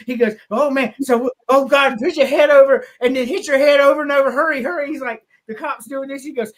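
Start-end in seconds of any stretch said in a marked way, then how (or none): 0.5-4.9 louder, higher imitated panic; mock frantic. Fletch rushing the cop through bug remedy, mock frantic. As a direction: urge it on in hammed-up alarm.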